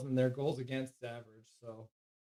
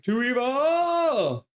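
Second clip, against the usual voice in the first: high, loud, stretched shout of too evil; shouted chat quote; 10 semitones higher, 7 dB louder, much slower than usual. Retold or imitated. Streamer hamming up a chat message as a long shout. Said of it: "And Zach's like".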